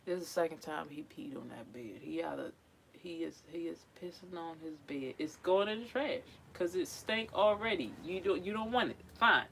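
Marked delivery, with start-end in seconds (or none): 1.0-5.1 whispered grumble; an exasperated whisper. Real, not acted. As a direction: hiss it under your breath about the cat. Annoyed muttering about the cat.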